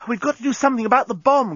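high, hurried alarm; urgent cry. A narrator's reading. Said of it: "cried Nettie".

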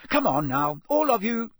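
high, loud chivvying; mother shooing. A narrator's reading. Mother ending the argument, loud and firm.

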